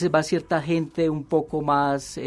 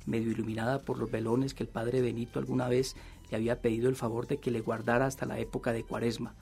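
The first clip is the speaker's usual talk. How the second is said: measured novel reading, softer than the chat; story narration; 5 dB quieter, faster than usual. A narrator's reading. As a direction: read the confession aloud as a storyteller. Reads novel excerpt aloud.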